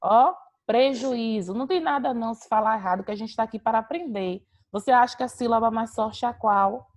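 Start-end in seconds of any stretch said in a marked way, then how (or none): 0.7-1.5 slow, stretched "prejuízo" with the Í brought out; stressed-syllable demo. Shown as a demonstration. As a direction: draw out "prejuízo" to show the strong Í. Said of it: "Aonde é que levanta aí?". ("prejuízo" devagar, realçando o Í)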